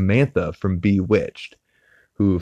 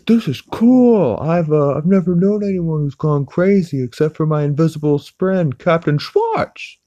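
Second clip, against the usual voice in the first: voicing Chris; 10 semitones higher, 5 dB louder than usual. Chris tells Lois about his invisible friend in a high goofy voice.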